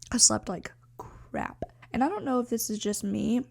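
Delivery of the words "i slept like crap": whisper. Pretend. Host teasing listeners with a mock ASMR whisper. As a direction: breathe the words like an ASMR clip. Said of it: "little A S M R for you".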